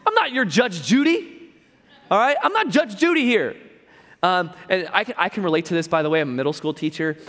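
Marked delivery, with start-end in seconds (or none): 0.1-3.5 high, loud and fed up, laughing; mock exasperation, half laughing; exasperated Jesus. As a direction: toss it off like a tired judge show host. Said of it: "fun to imagine Jesus saying it like this".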